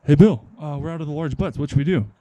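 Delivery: loud hail, then casual problem report; lightly voiced worker calling out to Bill; worker calling coworker. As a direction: call over to a coworker. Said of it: "and the guy was like".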